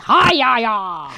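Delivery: Northern Irish hack veering towards Japanese; mock Northern Irish accent. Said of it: "It sounds a bit Japanese".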